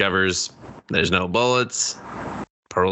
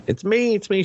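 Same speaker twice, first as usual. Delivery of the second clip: high-pitched imitation of panic; mock frantic; 11 semitones higher than usual. Retelling the scene as the frightened woman, mock frantic. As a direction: play a film character frantically identifying herself.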